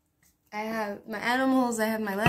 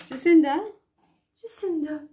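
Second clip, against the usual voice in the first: higher, louder call across the room; calling the cat; 6 semitones higher, 6 dB louder than usual. Real, not acted. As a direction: call her name to come over.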